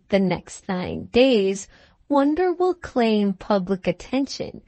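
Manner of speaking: ordinary delivery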